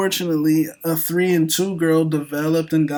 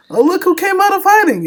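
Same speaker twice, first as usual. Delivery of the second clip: high, loud playful call-out; teasing exclamation; 8 dB louder than usual. Real, not acted. Mock greeting to Nico.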